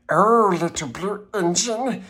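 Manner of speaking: plain reading voice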